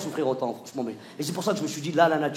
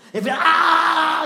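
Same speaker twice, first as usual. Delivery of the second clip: mock scream; 10 semitones higher, 8 dB louder, much slower than usual. Wife's scream.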